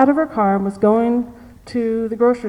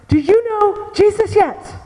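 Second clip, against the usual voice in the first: put-on funny old lady voice; 7 semitones higher than usual. Grandmother confronting the man who left her, high and funny. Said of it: "she talks in this very funny voice".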